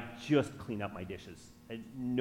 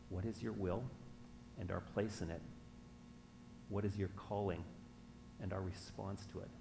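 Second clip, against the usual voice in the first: soft, lower, measured prayer; 4 semitones lower, 10 dB quieter than usual. Real, not acted. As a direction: pray quietly, with pauses between phrases.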